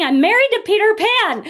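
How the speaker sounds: loud, high, furious cry up the stairs; angry outburst. Retold or imitated. Wife yelling down the stairs at her husband, loud and high. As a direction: hurl it at him in a retold rage. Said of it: "I get mad and I'm stomping".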